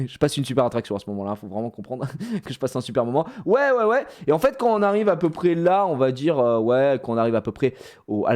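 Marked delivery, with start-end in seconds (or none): 3.5-4.1 high, put-on cheering; mock-enthusiastic string of cheers; reassuring guy. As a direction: fake a great time to keep a kid calm. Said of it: "le mec qui rassure".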